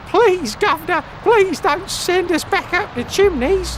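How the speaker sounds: high pleading Cockney urchin voice; old london cockney. Pretend. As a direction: beg like a sooty Victorian chimney sweep in Cockney. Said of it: "you feel like a Victorian urchin".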